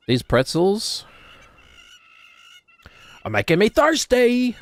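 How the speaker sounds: hammed-up shout, stretching the last word; exasperated comic yell. Streamer performing a pretzel catchphrase, hammed-up yell. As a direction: build to a high, loud outburst.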